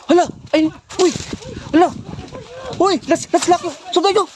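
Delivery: loud, high, urgent calls; excited shouting. Acted. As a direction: yell out as you give chase. Spotting the attacker, chasing.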